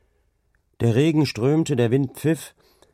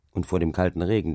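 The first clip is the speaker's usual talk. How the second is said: ordinary delivery, nothing marked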